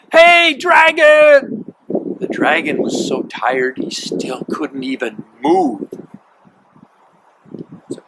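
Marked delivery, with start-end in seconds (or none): 0.1-1.7 high, loud, slow, drawn-out yell; loud shout. A narrator's reading. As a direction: yell it right into his ear. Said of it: "She shouted as loud as she could".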